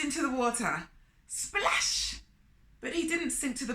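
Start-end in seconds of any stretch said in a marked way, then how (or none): 1.3-2.2 high, crisp splash word; splash sound. A narrator's reading. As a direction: spit it out like water hitting. Splash sound effect in story.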